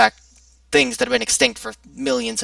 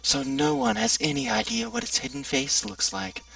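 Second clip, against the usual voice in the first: ominous tone; 11 dB quieter than usual. Shown as a demonstration. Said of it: "You have to say it with this really ominous tone".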